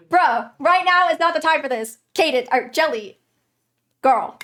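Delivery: loud protest at the game characters; exasperated scolding. Real, not acted. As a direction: scold them for bad timing. Player scolds the characters mid-danger.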